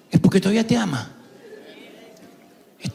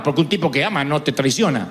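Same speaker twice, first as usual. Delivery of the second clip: normal talking voice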